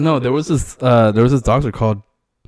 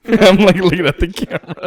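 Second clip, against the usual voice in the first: laughing, higher and louder; 8 semitones higher, 5 dB louder than usual. Real, not acted. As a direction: giggle through the words. Laughing, caught on camera.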